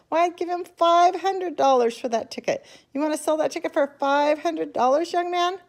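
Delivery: high, loud bidding voice; loud woman. A narrator's reading. Woman shouting her bigger offer to Charlie, high and loud. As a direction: outbid him at full voice. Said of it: "shouted a woman".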